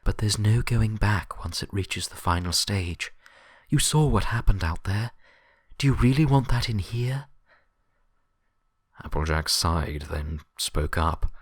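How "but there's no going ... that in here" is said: higher, louder urgent Twilight voice, building; urgent insistence. Acted. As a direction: press them harder with each question. Twilight urges the group.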